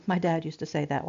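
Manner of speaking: normal talking voice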